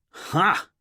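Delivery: a sudden "Ha!" of discovery; triumphant ha. Acted. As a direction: eureka exclamation, aloud. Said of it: "Richard said out loud".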